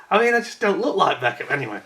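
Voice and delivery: ordinary talk, nothing marked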